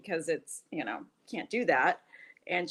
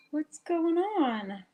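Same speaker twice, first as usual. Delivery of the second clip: drawn-out greeting; 8 semitones higher, much slower than usual. Real, not acted. Greeting a guest joining stream.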